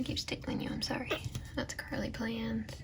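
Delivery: soft unvoiced whisper. Real, not acted. Mother whispering to the baby in her lap, soft whisper.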